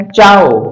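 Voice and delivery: चाओ in one syllable; Italian pronunciation. Shown as a demonstration. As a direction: say चाओ as "chow", a ch sound. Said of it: "इसका उच्चारण होता है".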